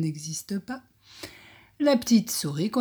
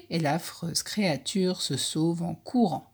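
plain reading voice

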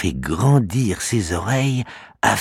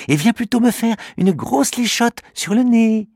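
put-on high, childlike girl's voice; a little girl taking her friend's side. Little girl Alori telling the dog to ignore her brother, childlike and high. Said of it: "dit Alori".

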